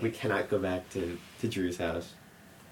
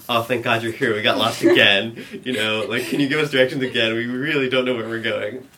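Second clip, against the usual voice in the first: mock relief; 3 semitones higher, 9 dB louder than usual. Planned mock plea to cop.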